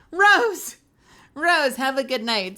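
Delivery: loud high goodbye call. Real, not acted. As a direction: shout the farewell brightly. Calling goodbye to viewer.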